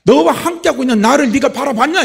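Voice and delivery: loud, high, forceful reproach; Holy Spirit's rebuke. Retold or imitated. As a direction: preach it as God confronting someone. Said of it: "성령께서 깨닫게 해주신 게".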